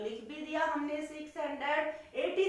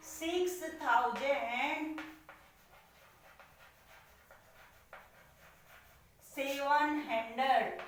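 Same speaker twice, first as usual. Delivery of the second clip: slow dictation; slower than usual. Shown as a demonstration. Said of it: "देखो बोल कैसे रहे हैं".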